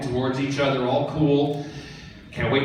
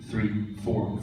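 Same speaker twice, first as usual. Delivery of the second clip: normal talking voice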